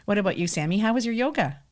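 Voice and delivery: ordinary delivery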